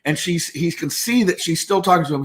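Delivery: ordinary talk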